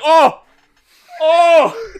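loud straining cries; pained grunts. Retold or imitated. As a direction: cry out like lifting hurts. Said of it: "you'd be like".

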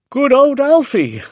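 high, loud happy cheer; cheerful exclaim. A narrator's reading. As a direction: cheer for the pet. Hoppy cheering the tortoise.

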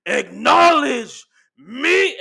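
slow, loud, high drawn-out acknowledge me; Roman catchphrase. Retold or imitated. Roman demanding respect from Jey, slow loud promo call. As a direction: boom it out like a wrestling promo.

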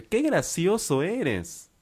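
high, very drawn-out sarcasm; sarcastic annoyance. Acted. Indignant friend to the classmate she thinks is joking, sarcastic. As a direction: stretch every word in mock indignation.

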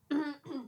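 sharp throat clear. Real, not acted. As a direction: clear your throat mid-sentence. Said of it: "Batuk mulu".

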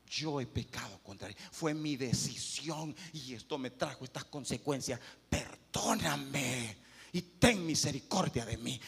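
repentant prayer, low and slow; humble confession. Shown as a demonstration.

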